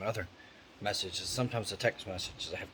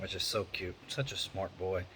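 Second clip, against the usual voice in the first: normal talking voice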